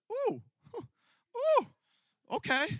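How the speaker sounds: high yelping oohs; high pained yelps. Retold or imitated. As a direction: squeal of pain then relief. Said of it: "I was like".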